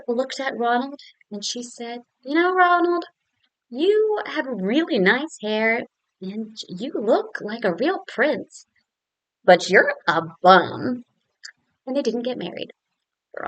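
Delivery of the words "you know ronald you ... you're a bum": slow, deliberate, sarcastic praise then a loud blunt insult; slow mock-sweet, then blunt; cutting put-down. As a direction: lay it on slowly then drop the insult. Elizabeth puts Ronald down.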